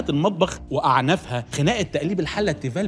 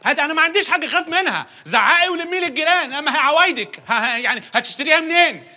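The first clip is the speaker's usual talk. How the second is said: much higher, loud angry taunt; husband daring his wife to call the neighbours; yelling husband; 11 semitones higher, 3 dB louder than usual. Acted. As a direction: hurl it back at her. Acted quarrel, husband yelling.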